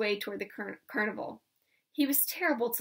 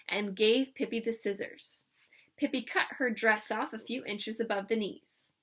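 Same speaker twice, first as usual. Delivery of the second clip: ordinary delivery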